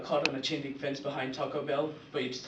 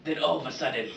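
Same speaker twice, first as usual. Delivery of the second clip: normal talking voice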